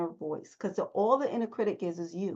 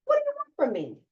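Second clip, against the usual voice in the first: pitched-up question; 14 semitones higher, faster than usual. Shown as a demonstration. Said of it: "ask this, this first step".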